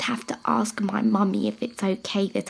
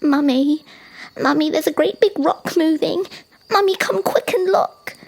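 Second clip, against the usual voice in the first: high, loud little giraffe calling out; excited calling; 8 semitones higher, 5 dB louder than usual. Acted. Young giraffe calling Mummy to look, high, loud and excited. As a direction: call urgently, climbing higher.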